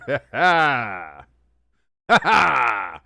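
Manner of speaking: loud laughing ha ha; hearty laugh. Real, not acted. Streamer laughing at a chat joke, loud laugh. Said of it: "That's a good joke".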